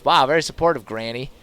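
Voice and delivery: ordinary delivery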